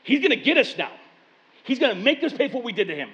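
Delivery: the brothers fearing payback; panicked brothers. Retold or imitated. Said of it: "They're like".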